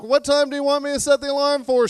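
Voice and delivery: husband setting the alarm, overly cheerful; sweet husband at bedtime. Acted. Husband asks again sweetly.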